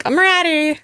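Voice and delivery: high, perky mock confidence; mock cocky. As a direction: ham up a breezy return. Mock cocky return line.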